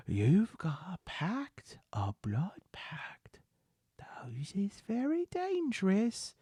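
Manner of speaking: slow, hushed, breathy warning; awed whisper. Acted. Beggar seeing sparks of arcane power, awed whisper. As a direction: whisper it slowly like a dread secret.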